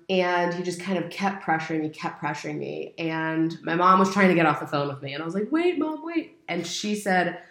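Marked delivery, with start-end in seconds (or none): 5.5-6.4 much higher, imitated plea to hold on; urgent pleading. Retelling stopping mom hanging up the phone, urgent pleading. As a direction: pitch it way up to stop someone leaving.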